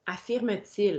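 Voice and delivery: normal talking voice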